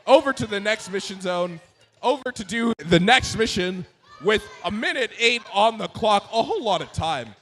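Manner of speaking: lively match commentary; play-by-play commentary. Acted. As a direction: call the action live. Live robotics play-by-play.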